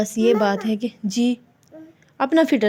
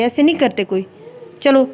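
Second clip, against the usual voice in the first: normal talking voice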